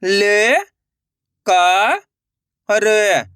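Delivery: ल, का, र each separate and held long; letter-by-letter demo. Shown as a demonstration. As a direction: sound out ल, का, र with pauses. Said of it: "एक अक्षर का उच्चारण सही से". (ल, का, र अलग-अलग खींचकर)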